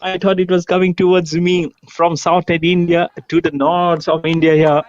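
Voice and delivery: loud, high thrilled commentary; excited shout. Real, not acted. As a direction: roar it like a huge six. Big hit called live.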